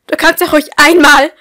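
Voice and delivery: loud, high screaming mother; furious mother. A narrator's reading. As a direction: scream it back at your daughter. Mother yelling at daughter. (wütende Mutter, laut schreiend)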